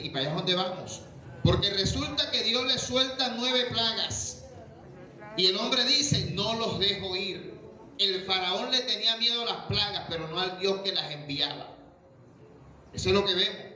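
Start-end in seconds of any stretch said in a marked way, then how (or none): 1.4-11.7 high, slow, rising preacher's build-up; preaching at full force. Acted. Pastor warning the congregation about Pharaoh, preaching high and slow.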